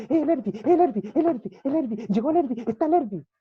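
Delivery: high, loud, repeated excited cries; hammed-up overjoyed squealed announcement; flustered excitement. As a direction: imitate a woman frantically announcing a visitor. Acted flustered sister announcing pastor's arrival.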